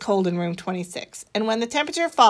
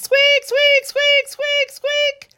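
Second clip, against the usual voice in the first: high, loud squeaking hamster; a hamster squeaking into the argument; hamster squeaks; 4 dB louder, much slower than usual. Acted.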